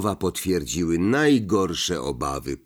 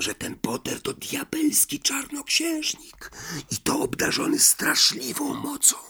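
hushed secretive whisper; 6 semitones higher, 4 dB quieter than usual. A narrator's reading. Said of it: "Zniżył głos do szeptu".

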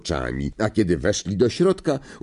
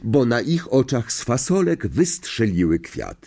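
ordinary delivery, nothing marked

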